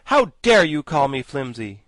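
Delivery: loud furious demand; loud angry How dare you; in a rage. A narrator's reading. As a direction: rage at the insult. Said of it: "cried Jack in a rage".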